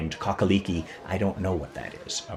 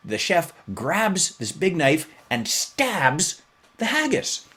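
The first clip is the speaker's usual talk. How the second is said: dramatic build; 10 semitones higher, 4 dB louder, slower than usual. Real, not acted. Dramatic stabbing moment, very dramatic.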